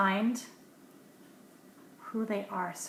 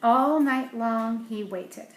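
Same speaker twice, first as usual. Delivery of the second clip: lively storybook read-aloud, a little louder; story narration; 6 dB louder, slower than usual. A narrator's reading. Parent reading a picture book to a child, lively storybook voice.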